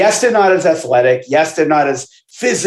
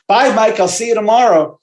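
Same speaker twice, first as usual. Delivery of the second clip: higher, chirpy mock sendoff; a lightly voiced smug trainer, higher; 8 semitones higher than usual. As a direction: imitate a smug trainer saying goodbye, pitched up. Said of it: "and he goes".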